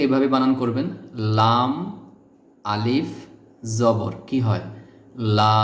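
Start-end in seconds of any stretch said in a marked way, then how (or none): 5.4-5.6 লা with the a drawn out long; long vowel. Shown as a demonstration. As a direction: stretch the a in লা. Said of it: "এখানে টান হবে". (লা টেনে লম্বা করে)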